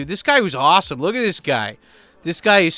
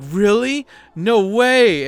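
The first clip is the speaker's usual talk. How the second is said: mock amazed; 7 semitones higher, 6 dB louder, much slower than usual. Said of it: "they're like".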